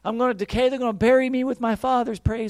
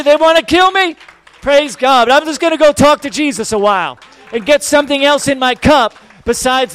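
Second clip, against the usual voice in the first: loud, rousing sermon climax; full-force preaching; 4 semitones higher, 11 dB louder than usual. Real, not acted. Pastor rousing the congregation to praise, loud preaching.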